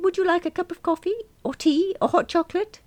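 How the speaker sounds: bright high secretary voice, quick; chirpy front-desk girl, high and fast; high receptionist voice. Acted. Receptionist offering drinks to client.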